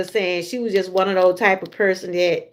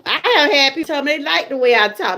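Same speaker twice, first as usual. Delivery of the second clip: ordinary talk, nothing marked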